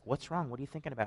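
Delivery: soft, quiet concerned wife; a hint of a worried wife, asked softly; lightly voiced wife. Wife asking her pensive husband, quietly concerned. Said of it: "my wife's like".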